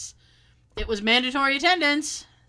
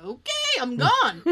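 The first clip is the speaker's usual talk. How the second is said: retold eager agreement; 2 semitones higher, slower than usual. Retold eager exit from work.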